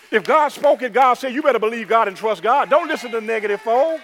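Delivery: loud, high exhortation; full-force preaching. Real, not acted. Preacher urging church to trust God, forceful. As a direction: belt it out to the congregation.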